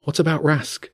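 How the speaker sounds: put-on high, hushed child's voice; young girl. A narrator's reading. Jenny questions the wolf secretly.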